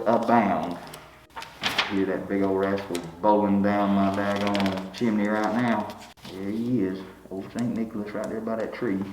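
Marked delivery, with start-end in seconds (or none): 1.6-9.0 softer hushed aside; whisper-quiet like spying on Santa. Acted. Man spotting Santa in his living room, hushed.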